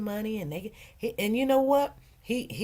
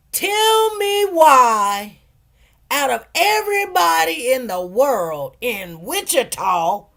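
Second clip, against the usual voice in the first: slow, much higher, loud disbelieving cry; stunned disbelief; 7 semitones higher, 12 dB louder, slower than usual. Retold or imitated. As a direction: drag it out in shock. Disbelief at brother appearing.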